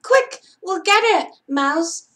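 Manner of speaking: rally Mouse into action; urgent cry. Acted. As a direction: Frank promising to fetch the book. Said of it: "cried Frank".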